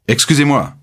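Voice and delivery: high-pitched, stern interruption. A narrator's reading. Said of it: "dit-il d'un ton sévère".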